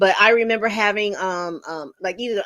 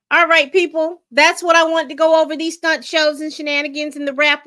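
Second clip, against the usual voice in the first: louder, calling the chat to order; loud announcement; 6 semitones higher, 6 dB louder than usual. Real, not acted. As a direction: grab the audience's attention. Loud opening address to chat.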